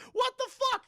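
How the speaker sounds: very high, loud mock squeal; hammed-up high-pitched disbelief; shocked outburst. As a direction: squeak it out in comic outrage. Acted shock at soccer ball hit.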